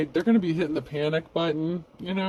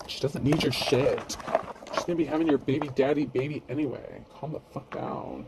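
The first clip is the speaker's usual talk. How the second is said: a hint of a bratty teen, talking down to Whitney; snotty teenager voice. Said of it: "that's also my snotty teenager voice".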